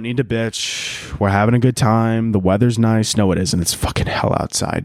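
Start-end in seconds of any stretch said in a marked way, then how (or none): none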